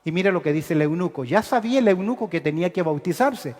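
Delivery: normal talking voice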